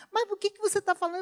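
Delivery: fast, high mocking husband voice. Wife mimicking husband's nagging about her speech, fast and high. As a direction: quickly parrot the husband's complaint. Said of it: "Ele diz".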